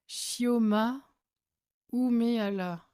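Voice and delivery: "Umehala" announced carefully, unsure of the pronunciation; careful name pronunciation. Real, not acted. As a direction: sound out "Shioma Umehala" deliberately. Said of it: "J'espère que je prononce bien".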